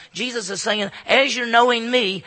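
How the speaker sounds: ordinary delivery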